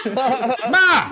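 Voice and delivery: hammed-up Italian-American son calling out; Italian-American accent. Man joking about being Italian, calling his ma in an Italian-American accent.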